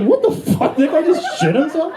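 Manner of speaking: high squeaky outrage; high horrified woman voice; shocked woman. Retold or imitated. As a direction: squeal it like a scandalized lady. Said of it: "she's like".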